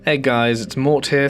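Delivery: ordinary delivery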